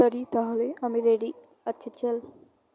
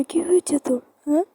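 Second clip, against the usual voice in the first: ordinary talk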